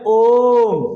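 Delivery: ओम held very long and loud, the vowel drawn out; a long, full ओम sustained like a chant; prolonged om. Shown as a demonstration. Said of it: "अ और आ से दोनों से अधिक समय लग रहा है".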